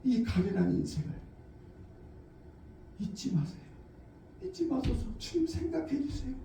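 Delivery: soft, hushed pleading prayer; quiet prayer. Shown as a demonstration. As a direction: beg God gently. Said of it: "하나님의 도움을 요청하는 거예요".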